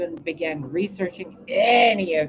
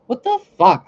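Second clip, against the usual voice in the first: loud, high burst of what the fuck; shocked exclamation, pitched up; loud disbelief; 7 semitones higher, 10 dB louder than usual. Real, not acted. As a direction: blurt it out in stunned disbelief. Exclaims in disbelief at her life.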